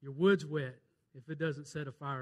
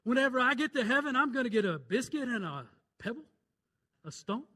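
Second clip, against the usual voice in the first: excited quote; 8 semitones higher, 7 dB louder than usual. Retold or imitated. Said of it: "And somebody said".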